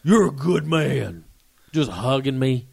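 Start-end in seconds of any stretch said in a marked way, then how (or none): 0.0-1.2 put-on sentimental drunk. Drunk man hugging narrator.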